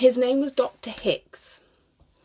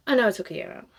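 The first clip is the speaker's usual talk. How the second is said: ordinary talk, nothing marked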